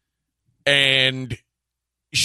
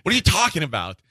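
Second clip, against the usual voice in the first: high, disbelieving, half laughing; mock his logic in disbelief; incredulous retort; 8 semitones higher than usual. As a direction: coach arguing back, high and incredulous. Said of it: "I said".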